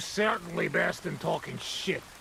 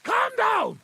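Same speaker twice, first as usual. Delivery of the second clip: high, loud commanding shout; 5 dB louder than usual. Acted. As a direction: yell at him to hold still. Friend yells over panic.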